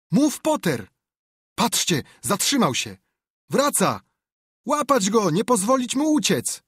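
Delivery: high, loud urgent shouts; rally the crowd to grab him; frantic crowd yells; crowd yelling. Acted. Crowd urges to catch him.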